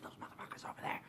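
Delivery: very quiet whispered gang line; mock whisper. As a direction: whisper it as if pointing someone out. Gang spots the guards, retold.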